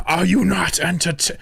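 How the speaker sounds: half-laughing nod to the Gladiator speech; playful Maximus impression, breaking into laughter; mock Gladiator quote. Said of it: "Someone said Gladiator Arena".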